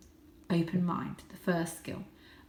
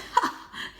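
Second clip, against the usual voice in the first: laugh. A narrator's reading. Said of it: "laughed out loud".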